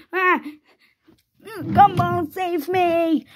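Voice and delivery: play-acted distress; mock cry for help. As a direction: call out for rescue, drawing out the wail. Trapped character calls for rescue.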